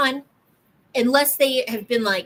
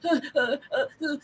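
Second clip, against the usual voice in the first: imitating a sobbing child; 3 semitones higher than usual. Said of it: "they'll be like".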